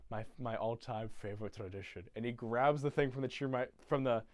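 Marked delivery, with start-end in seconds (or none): 0.1-2.0 low, soft acted Grinch; low mock suave. Grinch addressing Martha, low quiet put-on voice. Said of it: "at Martha and goes".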